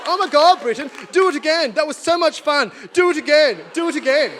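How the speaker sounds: high, loud mock enthusiasm; mock delighted natives. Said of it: "The natives are like".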